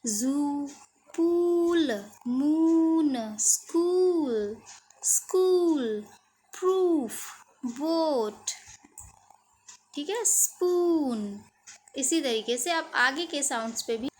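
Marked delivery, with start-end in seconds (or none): 0.1-4.6 पूल, मून, स्कूल slow with long oo; long oo words. Shown as a demonstration. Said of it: "उसको कैसे pronounce करना है". 5.0-6.0 whispered स्कूल; whispered word. Shown as a demonstration. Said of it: "कैसे pronounce करना है". 6.5-11.4 पूल, मून, स्कूल slow with long oo; long oo words. Shown as a demonstration. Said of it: "उसको कैसे pronounce करना है".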